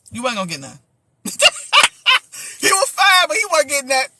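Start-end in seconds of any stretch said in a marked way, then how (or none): 1.2-2.5 high burst of laughter. Real, not acted. Bursts out laughing at own line.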